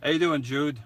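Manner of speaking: greeting Jude Brooklyn-style; hammed-up New York accent greeting. Shown as a demonstration. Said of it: "I'm gonna say it with my New York accent".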